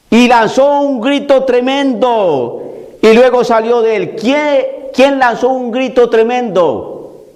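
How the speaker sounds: normal talking voice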